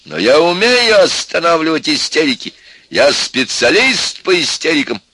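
loud, high, slow boasting tirade; in full swing. A narrator's reading. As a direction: hold forth at full volume, worked up. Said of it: "вовсю разошёлся Максимыч".

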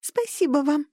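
bright tiny creature voice; beaming thin squeak; squeaky high voice. A narrator's reading. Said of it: "Куки просиял".

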